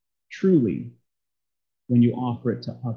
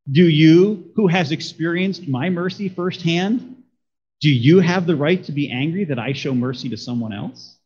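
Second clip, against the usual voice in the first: "you" punched and raised, then the question carried on rising; stress on YOU; 2 semitones higher than usual. Shown as a demonstration. God challenging Jonah who received mercy, stress on "you". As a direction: punch each "you" as a pointed personal challenge. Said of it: "could have emphasis on a different word".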